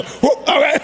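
high, startled whoop imitation; John. Preacher mimics a man reacting to his testing joke, high whoop. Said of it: "look at John, he go".

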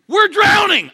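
high, loud panicked shout; hammed-up drowning sailor; mock panic. Crew shouting in shipwreck.

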